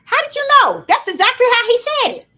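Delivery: excited. Real, not acted. Preacher amazed a listener echoed him, excited. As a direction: burst out in delighted disbelief.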